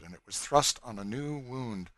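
ordinary delivery, nothing marked

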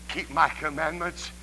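soft, low, earnest preaching; hushed appeal. Real, not acted. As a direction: drop low and plead with the congregation. Preacher drops to hushed, heartfelt appeal.

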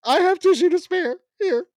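high, loud hammed-up teary voice offering tissues; mock weepy voice.

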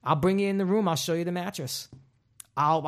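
usual speaking voice

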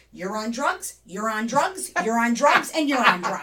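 loud mock accusation, rising on each repeat; escalating accusation. Child pointing at adults on the patio, imitated escalating accusation.

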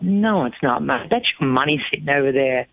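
ordinary delivery

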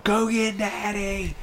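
high, drawn-out mock kid holler; imitating child's yell. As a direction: holler for help like an alarmed kid. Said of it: "I hear my oldest yell".

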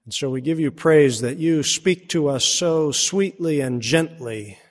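reverent prayer in the preacher's own voice, only lightly set apart; a hint of a hushed pastoral prayer. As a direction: pray it humbly to the Father. Leads closing prayer.

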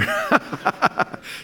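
high, real laugh. Real, not acted. Preacher amused after praising Diane to the congregation, high laughing. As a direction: break into a high-pitched laugh.

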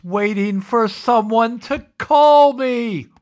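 building to a high, drawn-out shout; desperate wail. Retold or imitated. Young writer to the agent after two days by the phone, desperate wail. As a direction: stretch it out into a loud, high mock wail.

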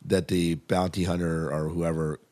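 ordinary delivery

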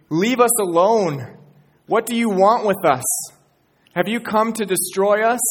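loud, high, accusing shout; shouting demon. Acted. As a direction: yell at Jesus like a possessed man. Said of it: "he shouts at Jesus".

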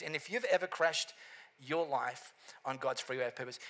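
normal talking voice

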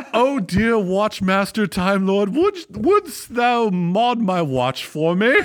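high, slow mock-Shakespearean plea; hammy stage actor. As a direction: ham it up like a knight begging. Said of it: "on one bended knee and said".

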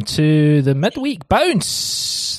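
slow, high, drawn-out show opener; announcer voice. Acted. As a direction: welcome them like a game show host. Host opens show announcer-style.

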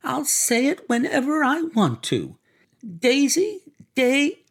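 very high, taunting woman's yell; high defiant outburst, cut off at the end; defiant shouting. A narrator's reading. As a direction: hurl it high, daring him to stop you. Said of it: "shouted Missus Wilson".

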